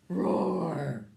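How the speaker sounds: big loud roar; dragon roar. Pretend. Parent leads child in a loud dragon roar.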